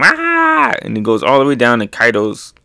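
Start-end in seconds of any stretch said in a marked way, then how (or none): 0.0-0.7 mock scream. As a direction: imitate a cartoon scream. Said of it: "you hear him scream".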